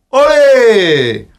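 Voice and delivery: high, loud joyous olé; hammed-up corrida shout; mock bullring cheer. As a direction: cheer like a whole arena. Bullfight crowd cheer, mocked.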